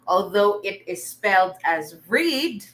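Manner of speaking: ordinary talk, nothing marked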